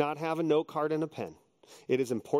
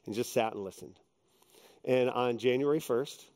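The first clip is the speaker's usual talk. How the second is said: normal talking voice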